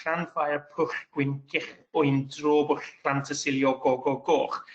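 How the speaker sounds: LLANFAIR PWLL through to GO GO GOCH in fluent Welsh sounds; Welsh place-name demonstration. Shown as a demonstration. Presenter showing students how Welsh sounds, LLANFAIR to GOCH pronounced in Welsh. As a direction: roll out LLANFAIR PWLL GWYN to GOCH as one long Welsh word. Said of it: "an indication of the kind of sound".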